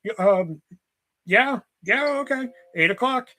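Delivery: stall, then agree in a lifted voice; hesitant girl. Retold or imitated.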